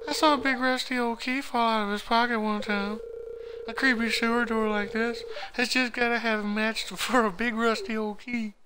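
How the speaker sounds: very high-pitched put-on kid voice; accented kid. Kid telling friend who has the sewer key, very high accented voice. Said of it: "that kid has an accent like that".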